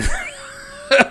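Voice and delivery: sudden high burst of laughter; real laugh. Real, not acted. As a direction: crack up mid-sentence. Cracks up while narrating.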